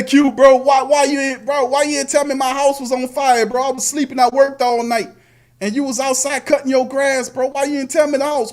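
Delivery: high-pitched mock complaint; aggrieved neighbour. Neighbour asks why nobody warned of fire, mock aggrieved. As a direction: playfully protest like a wronged neighbour. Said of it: "You gonna say".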